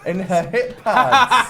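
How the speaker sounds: laughing through the words; cracking up mid-joke. Real, not acted. Laughing while joking.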